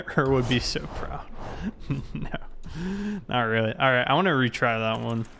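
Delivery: ordinary delivery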